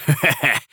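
proud chuckle of triumph; triumphant laugh. Acted. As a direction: laugh like you just won. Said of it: "Grandpa laughs in triumph".

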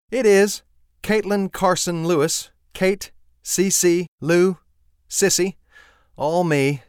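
high, loud, playful Southern lilt; Sissy, playful. A narrator's reading. Sissy introducing herself to Dave, high playful voice. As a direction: toss off the names with a grin. Sissy lists her names.

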